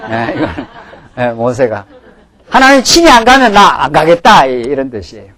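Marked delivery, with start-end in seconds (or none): 2.5-4.5 mock tantrum. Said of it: "이걸 땡깡이라 합니다".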